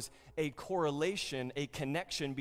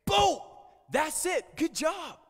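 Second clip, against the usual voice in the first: much higher, loud cheering shout; excited shout; 13 semitones higher, 9 dB louder than usual. Pretend. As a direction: cheer him on like a coach. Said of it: "I'm gonna imagine having Jesus say".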